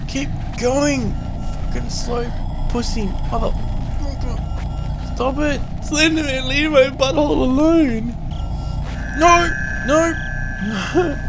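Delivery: high frantic breathless yelling; breathless fright. Real, not acted. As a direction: yell at himself in panic. Chased by Slender Man.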